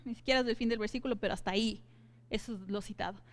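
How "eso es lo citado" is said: ordinary talk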